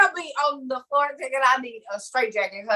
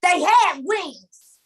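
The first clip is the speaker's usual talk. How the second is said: higher, emphatic protest; insistent retelling; 6 semitones higher than usual. Acted. Dreamer to a bro who is not listening, insistent. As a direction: correct a doubting listener urgently. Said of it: "That's what I would have".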